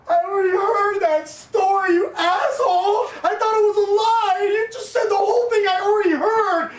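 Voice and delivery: loud, high mock-sobbing wail; crying. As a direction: imitate a girlfriend wailing an accusation through tears. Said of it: "she starts crying more".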